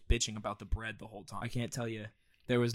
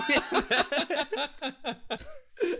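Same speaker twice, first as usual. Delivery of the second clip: a burst of high, real laughter; helpless giggling fit; big high laugh. Real, not acted. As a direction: crack up hard at your own joke. Cracking up at own story.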